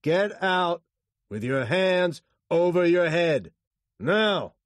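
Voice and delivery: loud, high, forceful commands; shouted orders. A narrator's reading. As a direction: shout instructions at gunpoint. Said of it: "he shouted at the driver".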